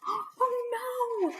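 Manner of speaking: high, soft crying voice; frightened cry. Acted. Granny terrified of the wolf, high crying. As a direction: whimper it in fright.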